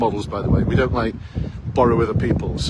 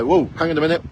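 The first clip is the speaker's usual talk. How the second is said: higher, louder cut-in; interrupting interviewer; 6 semitones higher, 4 dB louder, faster than usual. Pretend. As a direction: jump in and stop the candidate mid-answer. Interviewer interjecting mid-answer.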